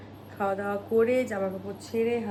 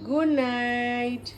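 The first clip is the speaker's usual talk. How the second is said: put-on mother drawing out good night for her toddler to copy; slow, drawn-out good night; 4 dB quieter, much slower than usual. Shown as a demonstration. Slow drawn-out demo. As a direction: stretch each word out slowly for the baby. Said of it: "বলো গুড night".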